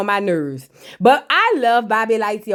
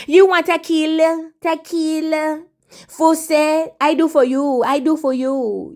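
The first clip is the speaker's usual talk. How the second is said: drawn-out mimicking voice, raised in pitch; Pinky Doll; 5 semitones higher than usual. Imitating a woman offering tequila and full sets, put-on chant. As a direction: parrot her repeated offers, stretching the words. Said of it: "that's how she sound".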